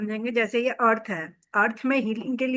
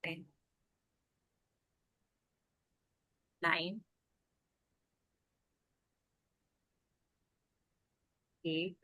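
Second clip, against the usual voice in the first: softer, lower, soothing meditation lead; guided meditation; 3 semitones lower, 4 dB quieter than usual. Acted. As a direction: gently guide listeners into deep relaxation, leaving space between phrases.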